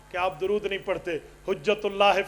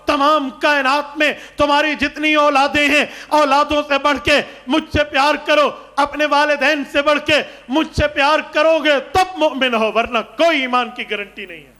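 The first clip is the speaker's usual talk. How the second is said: high, loud, driving sermon delivery; forceful raised-voice exhortation; impassioned preaching; 6 semitones higher, 9 dB louder than usual. Real, not acted. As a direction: preach it out at full voice.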